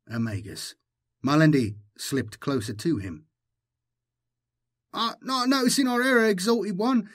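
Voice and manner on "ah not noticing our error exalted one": very high, fast, fawning gabble; high-pitched, hurried grovelling; babbling herald. A narrator's reading. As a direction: babble excuses to a master in a squeaky voice. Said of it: "babbled the herald".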